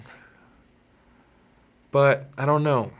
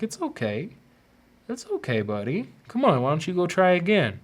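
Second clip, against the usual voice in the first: soothing parent; 7 semitones higher than usual. Retold or imitated. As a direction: comfort a crying little kid. Afterlife figure comforting child soul.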